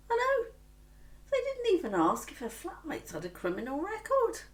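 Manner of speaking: high, loud exclamation of disbelief; indignant disbelief. Acted. Mother complaining about the patronising accommodation officer, indignant.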